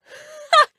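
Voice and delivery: short, high, loud burst of laughter; gleeful laugh. Acted. Laughing at her angry ex, high and gleeful. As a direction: let out a sudden loud cackle. Delights in ex's anger.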